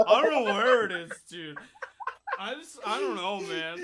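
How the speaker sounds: high, hammed-up sad drunk; mock upset drunk. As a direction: imitate a drunk friend pleading to be left. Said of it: "so sad".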